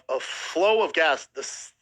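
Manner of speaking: ordinary talk, nothing marked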